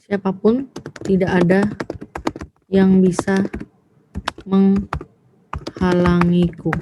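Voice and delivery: phrase by phrase with long pauses; slow dictation. Shown as a demonstration. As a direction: dictate it slowly, drawing out the last word. Dictates example, then rereads it whole. (mendikte pelan, terputus-putus)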